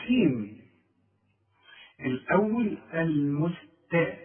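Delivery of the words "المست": المست- slow with thin m, s and t; light m and s. Shown as a demonstration. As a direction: keep every sound in المست- light. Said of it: "شوف مرققة الميم والسين إزاي؟". (المست- بترقيق وتمهل)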